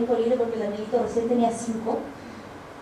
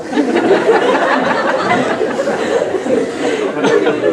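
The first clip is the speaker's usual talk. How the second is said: long louder airy laughter; 3 semitones lower, 6 dB louder than usual. Real, not acted. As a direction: keep laughing. (risa larga y fuerte)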